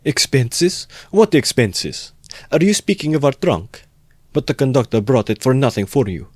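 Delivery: high-pitched indignant outburst; scandalised mother's fury; furious. A narrator's reading. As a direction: throw the accusation back in a high, offended voice. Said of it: "Pulcheria Alexandrovna was furious".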